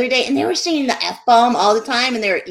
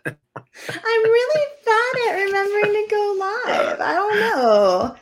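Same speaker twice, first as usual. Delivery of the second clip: whiny; 10 semitones higher, slower than usual. Real, not acted. Admits repeated mistake, whiny.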